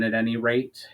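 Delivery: plain reading voice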